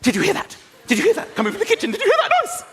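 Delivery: rising, frantic whispery-shout of a scared wife; wife nudging him awake, getting shriller; panicky wife. Retold or imitated. Wife hearing a noise at night, rising panic. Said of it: "She's like".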